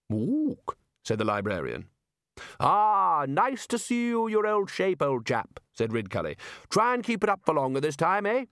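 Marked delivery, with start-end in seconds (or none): 0.1-0.8 higher hooting ape ook; a short orangutan hoot; ape grunt. A narrator's reading. Librarian ape answers. 2.6-8.4 hearty greeting. A narrator's reading. Ridcully greeting the librarian.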